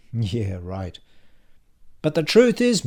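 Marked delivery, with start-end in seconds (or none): 2.0-2.8 higher, louder colleague's sales pitch; punchy, raised salesman voice; pushy salesman colleague. A narrator's reading. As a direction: pitch it hard to a workmate. Brad's acted sales pitch.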